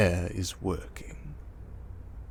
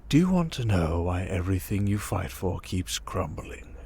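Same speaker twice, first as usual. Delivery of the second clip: ordinary talk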